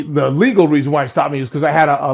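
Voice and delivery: ordinary delivery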